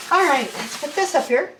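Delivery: usual speaking voice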